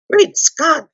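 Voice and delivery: high, loud burst of astonishment; amazed exclamation. A narrator's reading. Eustace hears what Jill has done, high amazed outburst. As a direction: exclaim it high, utterly taken aback.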